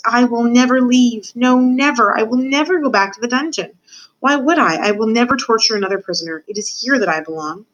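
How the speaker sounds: rapturous declaration, easing toward the end; exhilarated rat. Acted. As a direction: swear it in dizzy delight.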